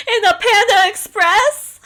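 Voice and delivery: high, loud, slow disbelief; loud slow comic question; incredulous. Real, not acted. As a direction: blurt it in mock disbelief. Joking reply, incredulous.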